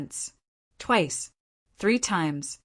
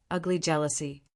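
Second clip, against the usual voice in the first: ordinary delivery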